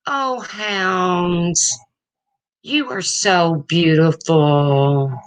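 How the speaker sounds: high, slow, swooning sweet talk; mock gushing fan. Mocking how he could sweet-talk his chat, high and drawn out. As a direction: coo each name like a lovesick fan. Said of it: "I could say".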